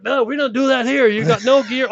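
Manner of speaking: a lightly voiced biker, stunned there's no gear; incredulous American biker. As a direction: play the local biker telling him off in disbelief. American bikers scolding his gear.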